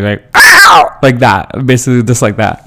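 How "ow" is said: shrill pterodactyl squawk, very high and loud; voice crack squawk. Retold or imitated. Teacher's voice cracking in class, very high loud squawk. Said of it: "that's what it sounded like".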